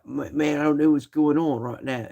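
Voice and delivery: mock British accent, pitched high. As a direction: put on a high, exaggerated British voice. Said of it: "I was speaking in a British frickin' accent".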